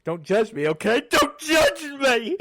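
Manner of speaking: loud, high whiny plea, building to a wail; hammed-up cry of don't judge me, rising sharply; mock pleading cry. Vlogger begs viewers not to judge his character choice, hammed-up wail.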